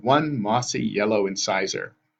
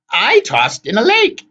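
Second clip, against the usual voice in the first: put-on slow, high, loud old sister; complaining hag; 14 semitones higher, 9 dB louder, slower than usual. A narrator's reading. Anger agrees in the squabble.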